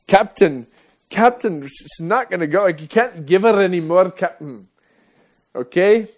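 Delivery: put-on loud, high Star Trek Scotty-style Scottish accent, pleading with the captain. Engineer yelling up to the captain in a Scottish accent. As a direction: shout it up to the captain in a thick Scottish burr. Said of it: "thank you for my, my wonderful Scottish".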